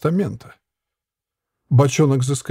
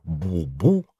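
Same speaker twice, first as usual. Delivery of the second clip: deep trumpet boom; 9 semitones lower, 4 dB quieter than usual. A narrator's reading. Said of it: "выдал на прощание из трубы особенно глубокое".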